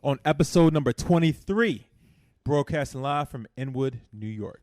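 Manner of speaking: loud, upbeat show opener; podcast host intro. Acted. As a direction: open the show like a radio host. Podcast opening, hosting the show.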